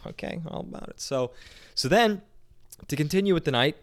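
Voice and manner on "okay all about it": whispered echo. Retold or imitated. Beau echoing the stranger, baffled whisper.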